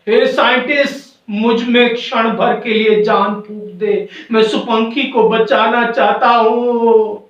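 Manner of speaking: high, impassioned acted plea; storybook yell ending on a long held word; crying out. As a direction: beg desperately for life, calling out. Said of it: "वो चिल्लाता है".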